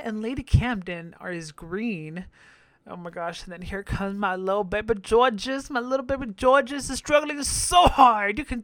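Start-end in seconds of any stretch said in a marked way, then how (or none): none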